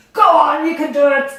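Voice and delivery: loud coaching call; encouraging shout. Real, not acted. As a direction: cheer the class on. Coach urging class on.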